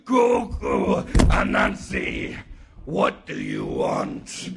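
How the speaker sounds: very low, slow giant's voice; booming sky god. Acted. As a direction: rumble it out like a huge god. Huge sky god addresses Anansi.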